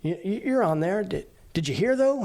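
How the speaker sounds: high, fast, eager voice for the stranger on the street; imitating the stranger. Sailor imitating the stranger asking about the news, high and eager. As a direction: pitch it way up and rush it like an excited local. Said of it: "He's like".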